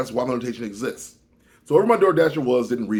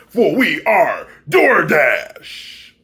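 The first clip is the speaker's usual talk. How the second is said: mock grand slogan; 4 semitones higher, 7 dB louder, much slower than usual. A sinister company slogan, slow and booming. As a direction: intone it like a sinister corporate motto.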